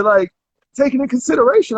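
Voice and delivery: ordinary talk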